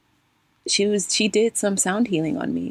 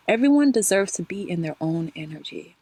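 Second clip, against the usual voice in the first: ordinary talk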